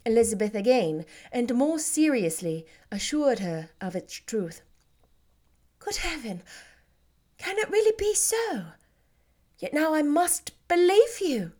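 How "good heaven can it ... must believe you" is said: higher, astonished sister's voice; gasping in wonder at the news; Jane, amazed cry. Acted. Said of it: "cried Jane".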